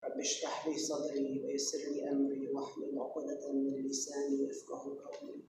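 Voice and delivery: rattled off fast in one breath; rapid Arabic invocation. Acted. Speaker opening his talk with Arabic blessings, rapid recitation. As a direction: rush through the formal opening blessing.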